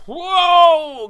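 high, loud, drawn-out whoa; sudden loud whoa. Real, not acted. Speaker halting own tangent, a loud exclaimed whoa. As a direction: pull up hard with a big whoa. Catching herself mid-tangent.

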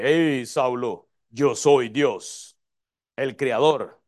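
low, slow mock-solemn introduction; Dios. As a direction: parody a grand self-announcement, drawn out and deep. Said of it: "Dios no se introduce". (voz grave y solemne, fingida)

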